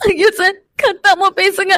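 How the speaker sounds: sobbing, tearful plea; crying. Acted. Woman asking sir not to shout, crying. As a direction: beg through tears. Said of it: "நீயா அழுதுட்டு இருக்காத".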